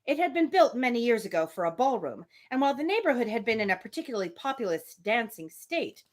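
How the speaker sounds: measured read-aloud voice for a classic novel; story narration. A narrator's reading. Reading a novel chapter to the listeners, in a steady narration voice. Resumes reading after audio fix.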